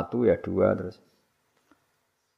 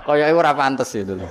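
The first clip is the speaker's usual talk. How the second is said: louder, said through laughter; laughing; 4 semitones higher, 5 dB louder than usual. Real, not acted. Preacher mocks the teacher's claim, talking through laughter. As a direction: keep laughing as you speak.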